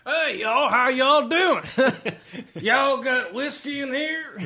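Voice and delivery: loud, high Texas holler; Texan cowboy cousin. Acted. Cowboy cousin greeting the household, loud high Texas accent. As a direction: burst in whooping with a Texas twang. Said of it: "my cowboy cousin from Texas".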